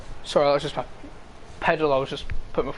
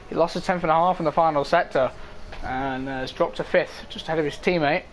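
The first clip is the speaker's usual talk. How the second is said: ordinary talk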